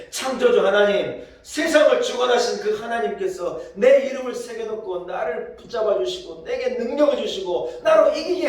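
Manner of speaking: loud, high, climaxing sermon shout; fervent preaching. Acted. Pastor proclaiming God holds us, loud rising preaching. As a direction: proclaim it with full force.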